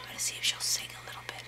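quick, very soft whisper; rapid whispered aside; hushed aside. Real, not acted. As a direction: whisper it so the bird keeps going. Aside while bird listens.

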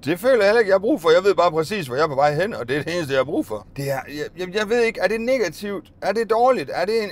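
normal talking voice

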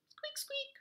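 very high squeaky mouse; mouse squeak. Acted. Mouse arrives.

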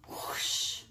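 breathy whoosh effect; whispered whoosh. Acted. Librarian making the transformation sound for kids, whispered whoosh. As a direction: blow the magic away.

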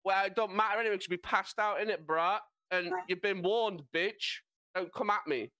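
cheeky, put-on British lad reading a disclaimer; exaggerated British accent. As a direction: ham up your own British accent. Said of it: "Do it in your accent".

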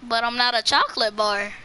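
normal talking voice

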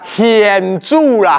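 现煮 drawn out slowly in Taiwanese; slow, amused demonstration of 现煮啦; Taiwanese reading. Shown as a demonstration. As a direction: stretch 现煮啦 to show the reading. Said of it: "你爱念现煮啦".

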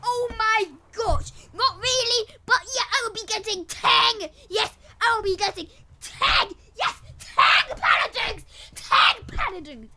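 over-the-top gamer hype; energetic voice. Shown as a demonstration. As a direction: yell it out with big energy. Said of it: "my energetic voice".

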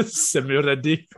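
slow, laughing echo. Real, not acted. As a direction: repeat it through laughter.

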